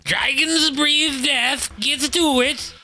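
high, loud and slow battle command; commanding shout. Acted. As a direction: bellow the order like a warlord. Character commands the dragonborn.

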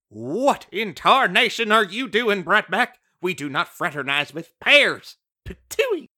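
put-on loud scolding old granny; Granny Smith scolding. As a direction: scold like a cranky old grandma, ending on a loud high patooey. Granny Smith scolding Bright Mac.

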